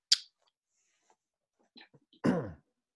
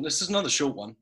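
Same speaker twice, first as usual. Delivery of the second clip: ordinary talk, nothing marked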